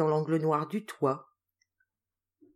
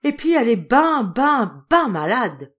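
loud, high despairing farmer; a farmer giving up on his cow, loud and high; 5 semitones higher, 9 dB louder than usual. A narrator's reading. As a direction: hammer the ben in despair. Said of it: "conclut Pitot".

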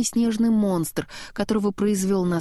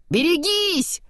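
high, raised warning cry; warning shout; 10 semitones higher, slower than usual. A narrator's reading. Kristoff warning Anna of the giant, high cry. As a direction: shout the warning, storybook style. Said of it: "крикнул Кристоф".

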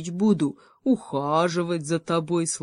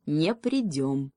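firm; slower than usual. Acted. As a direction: plant each word like a stone. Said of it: "твёрдо сказал ёж".

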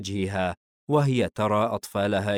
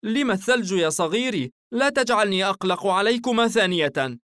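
anxious mother, pitched up; worried, fearful; 11 semitones higher, 5 dB louder than usual. A narrator's reading. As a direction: fret over a child in a higher voice. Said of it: "بقلق والخوف يعتلي نبرة صوتها".

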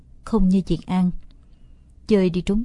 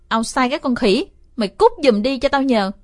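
rising angry snap, louder at the end; angry outburst; 4 semitones higher, 4 dB louder than usual. Acted. Kid snaps at his friend to get out, angry outburst. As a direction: tell him to get lost, fed up. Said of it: "Thấy tôi nổi đoá".

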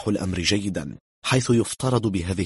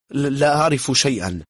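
high, louder panicky denial; nervous stammer; 7 semitones higher, 6 dB louder than usual. A narrator's reading. Frightened German denies knowing anything, nervous stammer. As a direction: deny it with a stutter. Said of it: "قال متمتما".

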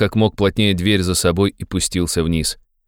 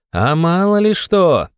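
high, drawn-out youthful reply; young man; 11 semitones higher, 3 dB louder, slower than usual. A narrator's reading. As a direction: answer slowly with a high, insistent lilt. Young man's dialogue voice. (протяжно, высоким молодым голосом)

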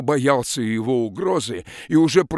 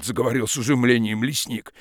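ordinary delivery, nothing marked

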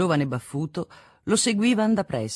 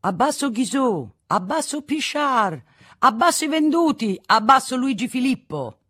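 raised, performed street chant; crowd cries; 8 semitones higher, 4 dB louder than usual. A narrator's reading. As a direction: call out slogans like protesters across a square. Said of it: "partì qualche grido".

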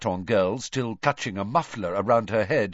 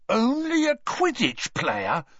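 high, slow incredulous echo; 12 semitones higher, slower than usual. A narrator's reading. Ron echoing Hermione in disbelief. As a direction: repeat her words as if they were absurd. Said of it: "as though he couldn't believe his ears".

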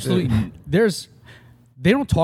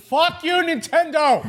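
shouting; slower than usual. Pretend. Mock rage at Nintendo.

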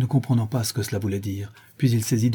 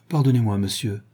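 plain reading voice

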